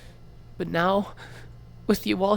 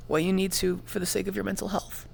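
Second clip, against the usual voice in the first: ordinary delivery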